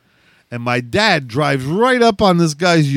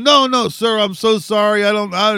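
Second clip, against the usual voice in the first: higher, hurried frightened babble; grovel, tripping over the words; babbling apology; 7 semitones higher than usual. Retold or imitated. Scared father babbling apologies to the angry dad. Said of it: "everybody's just fucking babbling".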